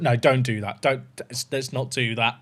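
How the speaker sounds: quiet retreat. Real, not acted. Streamer quietly backing off his own joke to chat, soft and low. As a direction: pull it back under your breath.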